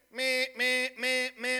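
flat repeated meh of a busy tone; imitated busy signal; busy signal imitation. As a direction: mimic the phone's busy beeps. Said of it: "You know what it means? Busy".

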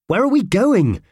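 high, loud yell on the run; yelled question. A narrator's reading. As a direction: yell after a friend who is sprinting off. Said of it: "yelled Howard".

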